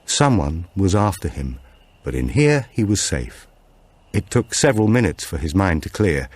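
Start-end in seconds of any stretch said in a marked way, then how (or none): none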